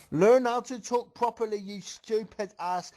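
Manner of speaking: high, loud, biting put-down, slowing on the insult; scolding insult. Acted. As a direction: snap at her for bad grammar. Insulting a friend's grammar.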